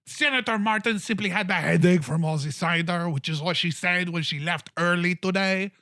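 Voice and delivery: mocking, loud and high; mayor scoffing at Cappy's story; high, loud sarcastic mayor. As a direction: sneer at the flimsy excuse.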